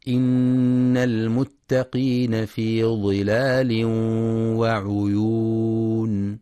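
slow chant with a long held last word; Quran recitation. Shown as a demonstration. Teacher chanting the verse for tajweed students, slow. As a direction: chant it, drawing out وعيون. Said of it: "في كلمة وعيون ينتبه القارئ".

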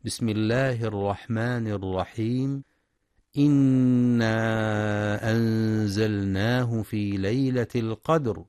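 demonstrating proper tajweed; Quran recitation. Shown as a demonstration. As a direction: recite with measured chanting. Teacher recites verses before explaining.

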